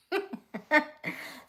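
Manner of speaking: loud high burst of laughter. Real, not acted. Laughing at stumbling over a word, high and loud. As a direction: laugh at your own stumble.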